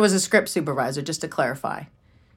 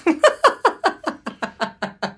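high laugh; 12 semitones higher than usual. Real, not acted. Sister laughing about tense home.

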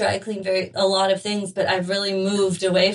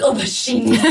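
laughing protest; 3 semitones higher, 7 dB louder than usual. Retold or imitated. Pleading with the taxi driver.

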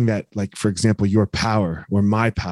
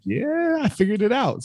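smug high quote; 9 semitones higher, slower than usual. Retold or imitated. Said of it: "I was like".

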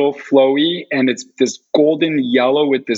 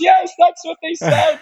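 high, eager yes, that's what they said; rushing, thrilled agreement pitched high; excited confirmation. Retold or imitated. Confirming the doctor's words, high and thrilled.

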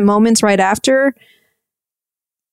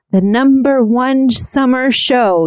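slow, higher movie-trailer announcer voice; Tom Bergeron's dramatic voiceover; 4 semitones higher, slower than usual. Retold or imitated. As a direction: draw it out like a dramatic promo narrator. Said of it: "Tom Bergeron's dramatic V O voiceover saying".

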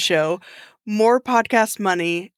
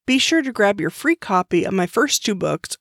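ordinary talk

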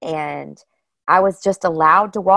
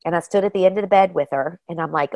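ordinary talk

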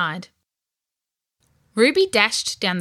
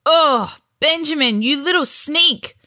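higher, louder scolding cry; indignant exclamation; 7 semitones higher, 6 dB louder than usual. A narrator's reading. Ruby accuses her brother over the worms, exclaiming indignantly. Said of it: "Ruby exclaimed".

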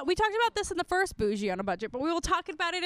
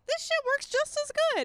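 excited; 14 semitones higher than usual. Retold or imitated. Acted quote of her own delight.

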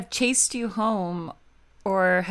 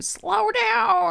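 high-pitched, drawn-out mock yell; mimicked shout down the street; imitated neighbor yell; 11 semitones higher than usual. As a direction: call out like neighbors scolding a speeder. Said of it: "yelled at you".

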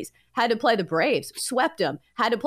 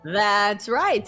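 usual speaking voice

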